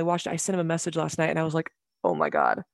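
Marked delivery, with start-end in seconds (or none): 2.0-2.6 low, hushed-pitch mock awe; retold stunned reaction. Texting a friend after watching the show, low stunned reaction. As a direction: drop the voice way down low.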